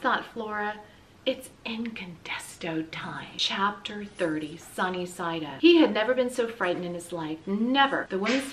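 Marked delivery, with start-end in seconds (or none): 1.2-3.3 slow excited whisper. A narrator's reading. Flora whispers to herself that the hero moment has come. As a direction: breathe it as a secret thrill.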